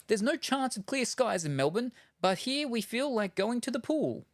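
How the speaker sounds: high-pitched, deliberate recital; read-aloud test sentence. Shown as a demonstration.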